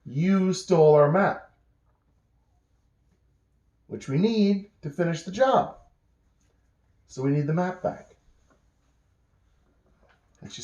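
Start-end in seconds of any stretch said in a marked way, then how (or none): 0.1-8.1 insistent protest. Acted. Brett insisting to Jill that they need the map back, high and emphatic.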